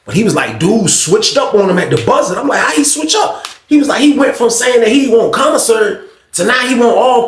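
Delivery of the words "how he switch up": surprised question. Retold or imitated. Asking about the dealer's change.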